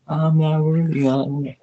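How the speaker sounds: a playful bow of fake awe; Wayne's World quote. Fan quoting Wayne's World to Ben, mock-reverent. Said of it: "the Wayne's World moment".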